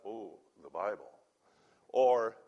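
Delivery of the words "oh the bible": low, hushed mock reverence; mock awe. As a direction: drop into a soft, deep imitation of awe. Mimics a reverent view of Bible.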